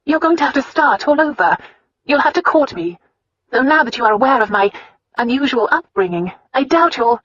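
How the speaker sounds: put-on a proud young wife confronting her husband; upset young wife. A narrator's reading. Wife's dialogue voiced much higher.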